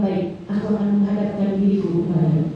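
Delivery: soft low voice answering the worshipper; quiet divine reply. Acted.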